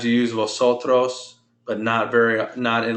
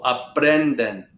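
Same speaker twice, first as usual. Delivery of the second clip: "Aprenden" slowly, as a-PREN-den; second-to-last syllable stress; slower than usual. Shown as a demonstration. Teacher correcting student's stress, slow "Aprenden" with weight on PREN. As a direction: lean on PREN in "Aprenden", drawing it out. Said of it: "put that stress on the second to last syllable".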